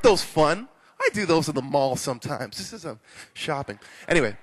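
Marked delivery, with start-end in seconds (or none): none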